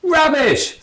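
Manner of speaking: high loud snort of contempt; loud scornful snort; snorted rubbish. A narrator's reading. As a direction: scoff it like a disgusted old woman. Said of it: "snorted a woman's voice".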